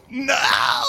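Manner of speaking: long, high-pitched mock wail. Kid caught after stealing food, mock panicked wail. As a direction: cry it out like a busted kid, hammed up. Kid's cry acted out once more.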